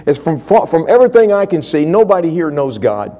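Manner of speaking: a man at the end of his rope; high, loud desperate confession; desperate outpouring. Retold or imitated.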